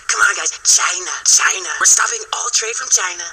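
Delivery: mock a blustering president's New York accent; Donald Trump. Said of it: "And this stupid accent".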